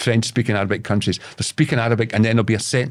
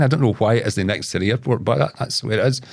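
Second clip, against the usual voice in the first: ordinary talk, nothing marked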